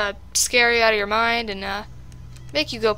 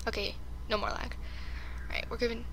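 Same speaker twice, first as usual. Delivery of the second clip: whispered, much softer; 14 dB quieter, faster than usual. Real, not acted. Gamer whispering so the animatronics won't hear. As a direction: drop to a whisper. Gamer hushes during tense game.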